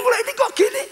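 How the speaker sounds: high-pitched acted disbelief; bewildered. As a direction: voice a patient baffled by worse news. Bewildered patient retold. (heran dan bingung, nada tinggi)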